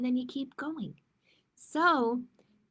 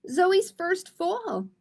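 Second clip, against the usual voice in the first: slow, high and louder, beaming; cheerful announcement; 6 semitones higher, 4 dB louder, much slower than usual. Acted. Mom announcing the family celebration, bright and proud. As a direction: announce it like happy news.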